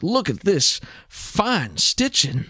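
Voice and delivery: drawn-out put-on admiration; bluffed admiration. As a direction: savor it like a bluffing connoisseur. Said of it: "he's confident that he can bullshit this".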